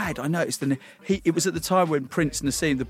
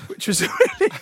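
ironic laugh; 13 semitones higher than usual. Real, not acted.

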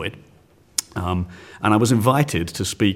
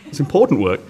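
defensive; 5 semitones higher, 4 dB louder than usual. Real, not acted. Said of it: "You don't have to justify it".

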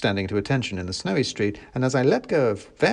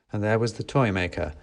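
ordinary delivery, nothing marked

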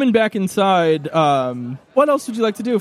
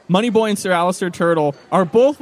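ordinary talk

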